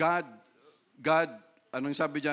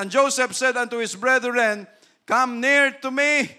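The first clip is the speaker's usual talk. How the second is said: scripture reading; 5 semitones higher, 6 dB louder than usual. Acted. Pastor reading Genesis aloud to the church, in a solemn, ringing voice. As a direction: proclaim the verse to the congregation.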